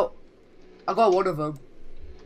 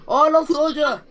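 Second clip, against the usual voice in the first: loud dismay; 8 semitones higher, 5 dB louder than usual. Real, not acted. Losing a teammate in game.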